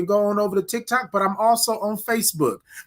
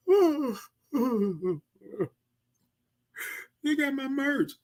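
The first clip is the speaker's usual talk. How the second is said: retold delighted squeal; 7 semitones higher, 7 dB quieter, slower than usual. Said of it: "and I said".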